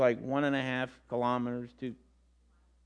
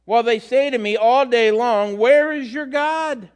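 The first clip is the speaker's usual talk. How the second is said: higher, louder preached psalm; scripture reading; 7 semitones higher, 7 dB louder than usual. Acted. Preacher to congregation, loud proclaimed psalm. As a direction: proclaim the verse with rising force. Reads psalm verse two.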